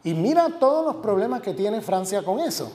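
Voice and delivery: ordinary talk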